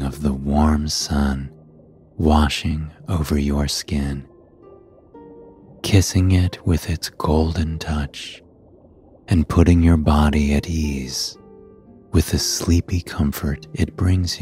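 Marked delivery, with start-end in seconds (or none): none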